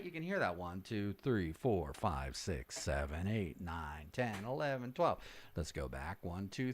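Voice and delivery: soft, low, distant counting; off-axis from mic. Shown as a demonstration. Coach demonstrating off-axis sound, faint low count. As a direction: count while turned away from the microphone. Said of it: "I'm getting off axis from the microphone".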